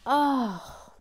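loud, high groan of frustration; exasperated groan. Real, not acted. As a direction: groan out loud at your own blunder. Groans at her own mistake.

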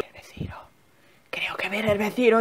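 urgent whisper. Real, not acted. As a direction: whisper urgently while hiding. Hiding from neighbour.

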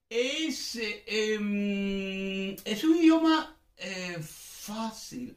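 high, drawn-out teacher reassurance, slowing on fácil; encouraging teacher voice. Acted. Teacher cheering on nervous students, high and slow. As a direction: sell it to students as easy. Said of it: "Me salió esto de profesor".

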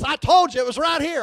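high, shouted wife imitation; mock exasperated wife. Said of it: "A woman walks in there and says".

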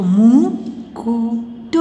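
मुकुट very slow with gaps between syllables. Shown as a demonstration. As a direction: sound out मुकुट piece by piece. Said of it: "sound pronounce करके देख लीजिए". (मुकुट को रुक-रुक कर, धीरे)

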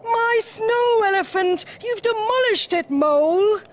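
high, loud dismayed protest; trumpeting elephant. A narrator's reading. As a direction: wail the accusation as an upset elephant. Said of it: "trumpeted Elephant".

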